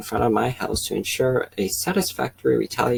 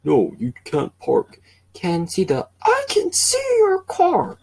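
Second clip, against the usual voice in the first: Kevin voice; 9 semitones higher, slower than usual. Acted. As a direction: whine it up high as the angry neighbor. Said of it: "my Kevin voice".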